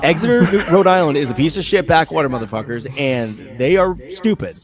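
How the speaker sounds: loud, high-pitched comic tirade; mock angry rant. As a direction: lay into the town at full volume. Comic rant against a town.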